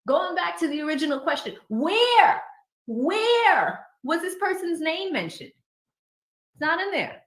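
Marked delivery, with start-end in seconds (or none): 1.7-5.5 WHERE stretched high and loud, twice; exasperated emphasis. Retold or imitated. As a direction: hammer a long, high "where". Acting out the judge's repeated question.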